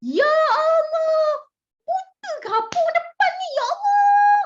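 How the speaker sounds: very high, loud, drawn-out wail of annoyance; exasperated outburst. Acted. Teacher acts a driver blaming the car in front, exasperated high shout. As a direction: wail in outrage at the driver ahead. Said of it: "macam mana cara kita jawab".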